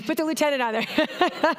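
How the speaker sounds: high-pitched, amused repeat through laughter; laughing. Real, not acted. Speaker repeating an audience member's joke about the lieutenant, laughing. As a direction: echo the heckle, cracking up.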